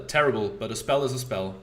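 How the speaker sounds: ordinary talk, nothing marked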